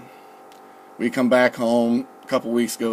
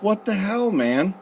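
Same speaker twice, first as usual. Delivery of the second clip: retold protest, pitch jumping up; exasperated protest; 8 semitones higher than usual. Protesting bed shaking while nauseous.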